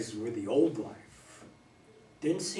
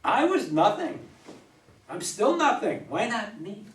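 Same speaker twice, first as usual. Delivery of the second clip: loud, much higher, raw despair; anguished shouting; 7 semitones higher, 10 dB louder than usual. Acted. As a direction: shout out his worthlessness in grief. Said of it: "Pounding the bar".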